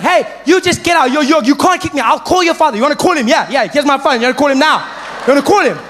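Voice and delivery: high, loud threats and retorts; heated classroom quarrel. Acted. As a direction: fire challenges back at full volume. Teacher and pupil quarrel escalates.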